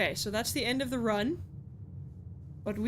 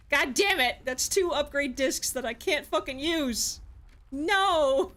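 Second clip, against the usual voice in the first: raised-pitch outburst of frustration; exasperated gamer outburst; mad; 4 semitones higher, 4 dB louder than usual. Real, not acted. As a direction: protest angrily, building to a loud No. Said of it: "I'm so mad".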